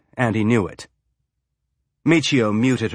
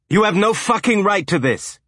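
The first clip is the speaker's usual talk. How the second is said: high, shouted fury at the captain; angry shout; 10 semitones higher, 3 dB louder than usual. A narrator's reading. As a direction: yell it like a furious ship owner. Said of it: "the owner of the hornblower shouted".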